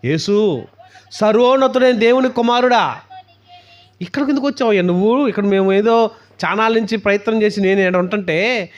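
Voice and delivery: high, loud outcry to Jesus; possessed man crying out. Retold or imitated. Demon in the man crying out to Jesus, loud protest. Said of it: "ఆయన ఎదుట సాగిలపడ్డాడంటాడు".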